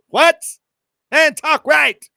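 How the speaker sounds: hammed-up friend scoffing at stiff Spanish; mock outraged reaction. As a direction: yell at him in disbelief to talk normal. Mocking reaction to proper Spanish.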